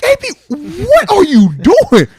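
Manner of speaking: exasperated yell. Retold or imitated. Teammate yelling at A B for starting a haircut before the flight, exasperated shout. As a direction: yell at him, stunned by what he sees.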